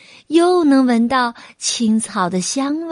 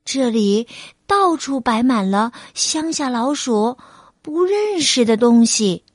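usual speaking voice

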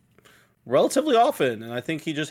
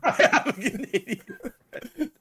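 much higher, laughing mock voice; mocking echo; 13 semitones higher than usual. Teasing cohost, echoing his excuse in a laughing high voice. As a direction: parrot the friend's line back in a silly high voice.

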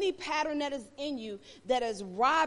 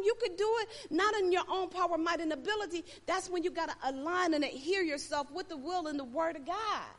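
ordinary talk, nothing marked

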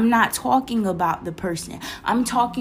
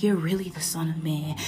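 low, softer voice of the tempter; taunting enemy; 5 semitones lower, 6 dB quieter than usual. Retold or imitated. Enemy testing Jesus in the wilderness, imitated low taunt. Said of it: "That's how I used to hear the".

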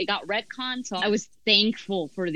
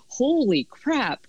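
slow, higher-pitched mock astonishment; retold shock; 5 semitones higher, much slower than usual. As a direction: stretch it out in replayed disbelief. Retells shock at finding out later.

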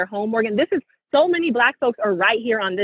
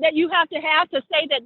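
ordinary talk, nothing marked

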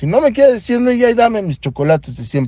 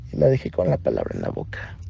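hushed, much softer whisper; 12 semitones lower, 10 dB quieter, faster than usual. Real, not acted. Telling how he walked out on the doctor, smug whisper. Boasting he left her speechless.

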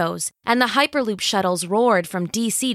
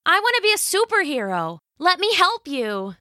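put-on higher, fast and eager girl; eager teenage girl; 10 semitones higher than usual. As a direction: gush like a thrilled teen. Eager teen heroine.

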